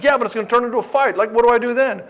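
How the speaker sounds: worried client. Retold or imitated. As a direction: panic about the coming fight. Client worried about fight.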